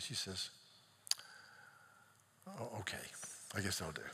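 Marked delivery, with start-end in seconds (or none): 2.5-4.0 soft, breathy concession under the breath; resigned whisper. Retold or imitated. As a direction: give in quietly, barely voiced. Official conceding to the pastor.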